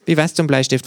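hohe, verspielte Känguru-Stimme. A narrator's reading. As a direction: frech und hoch als Känguru. Känguru fragt. (freche, hohe Känguru-Stimme)

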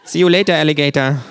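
hohe, verspielte Känguru-Stimme. A narrator's reading. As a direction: frech und hoch als Känguru. Känguru verabschiedet sich. (freche, hohe Känguru-Stimme)

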